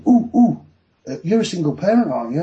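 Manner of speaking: pantomime prompting. Retold or imitated. Judge pointing at the defendant with a leading question, pantomime prompting. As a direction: imitate a judge hamming up a hint. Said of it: "like a pantomime and he went".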